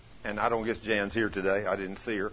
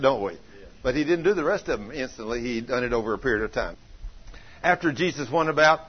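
ordinary talk

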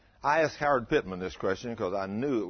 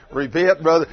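much louder, playful rebuke; mock preaching call; 4 semitones higher, 12 dB louder than usual. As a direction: jokingly call the man out. Joking at an audience member.

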